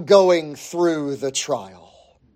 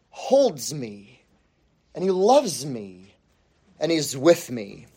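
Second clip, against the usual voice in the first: soft reassurance; 5 semitones lower, 6 dB quieter than usual. Acted. Softly voicing trust in God.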